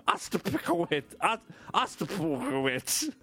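Ostapowicz drawn out with a garbled middle; fumbled surname. Real, not acted. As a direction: trip over Ostapowicz and restart it. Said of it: "I don't know how to pronounce that".

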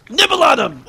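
high put-on voice, shouted; goofy cartoon shout; comic shout. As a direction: yell it in a silly high voice. Torso character shouting.

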